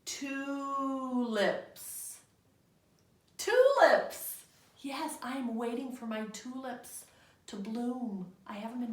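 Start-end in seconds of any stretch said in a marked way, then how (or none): 0.0-2.2 Tulips blended into one smooth word; read faster. Shown as a demonstration. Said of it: "Read it faster". 3.4-4.4 much higher and louder; triumphant classroom cheer; Tulips, high and loud with delight; excited answer. Shown as a demonstration.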